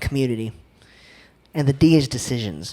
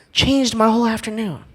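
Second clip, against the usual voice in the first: ordinary talk, nothing marked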